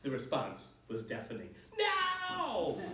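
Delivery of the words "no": put-on high, loud children's no; children shouting. A narrator's reading. Children deafeningly refusing the witch's song. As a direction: yell it as a crowd.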